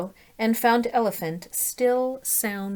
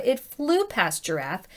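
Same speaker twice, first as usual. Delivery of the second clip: usual speaking voice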